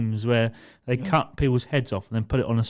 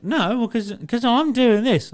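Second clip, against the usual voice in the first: mimicking backchat; 8 semitones higher, slower than usual. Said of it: "Not fucking".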